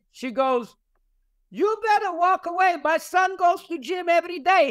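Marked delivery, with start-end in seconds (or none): 1.5-4.7 put-on much higher, protesting old lady; old Bulgarian lady. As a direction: defend her son to the cops, pitched way up. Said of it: "she's this old Bulgarian lady".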